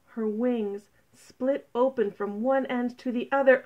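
ordinary delivery, nothing marked

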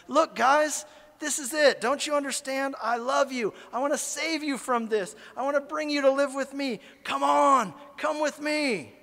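high, loud, earnest begging shout; pleading yell. Pretend. Speaker to maggots showing his drawing, high loud pleading yell. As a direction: plead at full voice for them to come. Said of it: "no matter how loud you yell".

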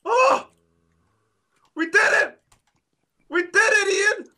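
high, whooping shout; elated cheer. Real, not acted. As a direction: cry out in real triumph. Celebrating a narrow win.

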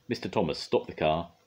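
ordinary delivery, nothing marked

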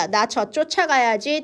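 ordinary talk, nothing marked

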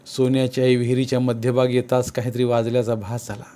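usual speaking voice

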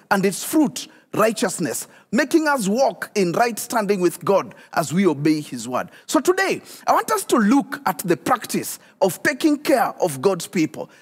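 normal talking voice

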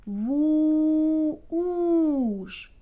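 "ву-, уш" with a long held У; розтягнутий звук у. Shown as a demonstration. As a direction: stretch the У in "ву-, уш". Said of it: "Який у нас звук у?". (протяжне У у "ву-, уш")

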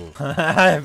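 loud real laugh; burst of laughter. Real, not acted. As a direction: crack up at the player. Laughing at player. (kahkaha atarak gülme)

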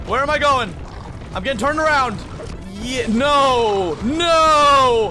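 high, loud yells of panic while lost; real panicked yelling. Real, not acted. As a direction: shout in real alarm, voice up high. Panicked escape in the game.

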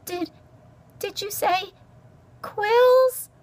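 very high, halting mouse squeak; stammered. A narrator's reading. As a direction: stammer it out, pausing in fright. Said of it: "she stammered".

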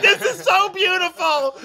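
high gushing delight; overjoyed gush. Retold or imitated. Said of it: "And I was like".

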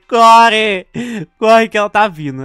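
frantic call to run; urgent shout. Real, not acted. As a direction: yell it out, urgent and loud. Urging a teammate to run.

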